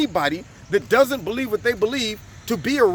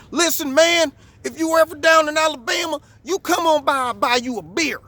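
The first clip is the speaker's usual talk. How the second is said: friendly Southern invite; 7 semitones higher than usual. Retold or imitated. Flag-waving fan inviting him to Alabama, lightly voiced hearty good old boy. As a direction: hail him like a buddy offering a beer.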